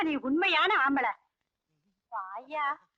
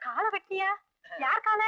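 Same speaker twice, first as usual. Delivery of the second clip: alarmed question; 5 semitones higher, 3 dB louder than usual. Acted. Wife alarmed at cut leg.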